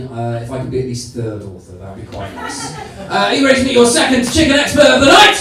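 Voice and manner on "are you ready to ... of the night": raised, high announcer's call; hyping the crowd. Real, not acted. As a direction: build to a loud question for the crowd. Host hypes up the next act.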